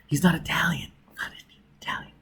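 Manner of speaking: unvoiced urgent whisper. Robin correcting herself to Foyle, urgent whisper. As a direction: breathe the correction.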